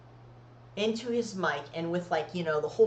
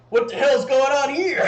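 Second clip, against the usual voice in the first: put-on loud, higher shout of a startled boyfriend; boyfriend's outburst; 6 semitones higher, 11 dB louder than usual. Boyfriend walks in on the lap dance, loud startled shout. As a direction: bark it like you just walked in on something. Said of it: "he's like".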